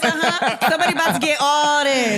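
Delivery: high, hammed-up hip hop girl; mock party girl. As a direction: tease like a girl rapping at a guy.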